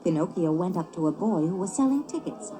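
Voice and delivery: usual speaking voice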